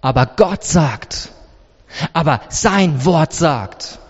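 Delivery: low, measured, sure answer; calm firm rebuttal. Acted. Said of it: "Jesus antwortet und schaut ihnen ins Gesicht". (ruhig, tief und bestimmt)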